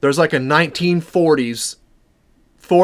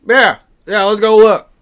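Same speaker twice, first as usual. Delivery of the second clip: backwoods guy voice; 5 semitones higher, 6 dB louder than usual. Retold or imitated. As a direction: play the rough local man. Same backwoods man agreeing.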